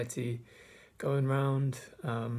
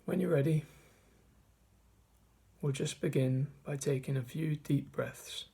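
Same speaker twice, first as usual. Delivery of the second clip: measured meditation guide, long pauses between phrases; guided meditation. Acted. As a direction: guide listeners through the breath, leaving space after each phrase. Leads a mindfulness meditation.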